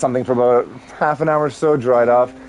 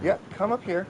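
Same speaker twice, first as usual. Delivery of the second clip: higher, soft pet talk to a scared puppy; soft higher voice for a frightened dog; coaxing the dog; 6 semitones higher, 9 dB quieter than usual. Real, not acted. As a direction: coax him up gently.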